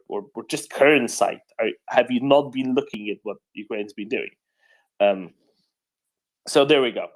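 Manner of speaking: usual speaking voice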